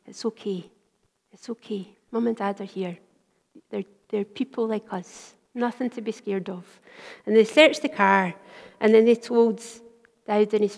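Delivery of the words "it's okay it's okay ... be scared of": put-on very soft soothing to children; comforting. Father soothing his kids outside the car, very soft. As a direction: hush them gently.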